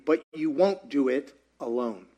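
ordinary delivery

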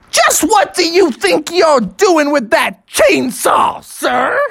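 high, slow, emphatic cop voice; stern police officer. Acted. Officer questions dad about the chainsaw, stern. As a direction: confront them with exaggerated authority.

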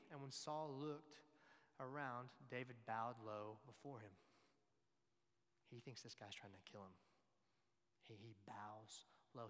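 ordinary delivery

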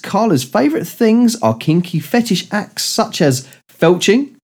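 usual speaking voice

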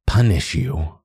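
cruel, relishing words; curling menace. Acted. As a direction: let the words curl cruelly. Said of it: "Her voice curls on the last two words".

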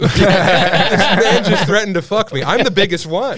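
loud high laughing telling. Real, not acted. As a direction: laugh through the panic. Laughing through the story.

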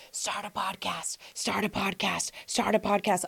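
soft inner voice, fading in from very soft; a soft nagging voice in your head. Retold or imitated. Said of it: "the voice said".

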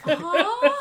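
high, loud, incredulous huh; baffled huh. Retold or imitated. Hearing the oils-in-the-Bible claim, a loud, baffled huh. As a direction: sound completely thrown.

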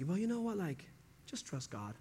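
high then dropping to a flat near-mutter; mock pious brush-off; dismissive brush-off. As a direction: toss it off like a pat platitude. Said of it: "all their response is like".

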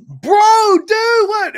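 spotting his hero right behind him, flabbergasted; shocked squeal. Retold or imitated. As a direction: yelp it like you just got caught off guard. Turning to see Davey Todd.

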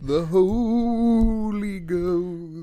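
very drawn-out, high, wailing ghost voice; hammed-up spooky howl; mock-ghostly wail. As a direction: wail it slowly like a pantomime ghost. Joins in the mock-spooky reveal.

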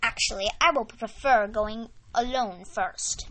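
ordinary delivery

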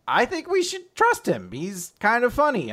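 high-pitched cartoon voice, easing down; lone defender's voice. Shiki alone defends the captain, high-pitched. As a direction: pipe up in a high character voice.

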